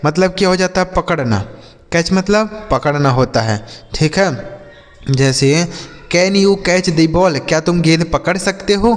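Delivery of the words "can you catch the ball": slow, careful English question; slow model reading. Shown as a demonstration. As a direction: read it out slowly for learners. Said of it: "slow model sentence".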